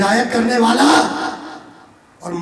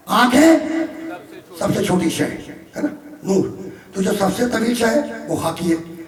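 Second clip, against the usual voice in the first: normal talking voice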